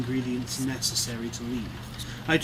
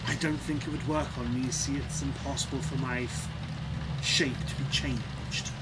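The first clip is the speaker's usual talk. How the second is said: sly villain voice; 2 semitones higher than usual. Acted. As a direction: breathe the word excitement, hushed. GM voices Strife bartering.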